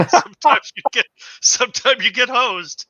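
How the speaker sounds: real amused outburst, laugh breaking in. Real, not acted. Player joking about spending on a bad gamble, laughing.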